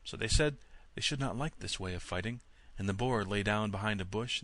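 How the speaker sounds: ordinary delivery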